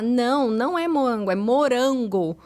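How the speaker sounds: acted over-careful correcting; correcting a child. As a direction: spell out "morango" slowly for a child. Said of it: "Não vou falar".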